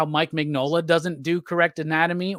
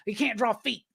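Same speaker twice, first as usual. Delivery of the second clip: mocking critic; 5 semitones higher, 5 dB quieter than usual. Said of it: "And I go".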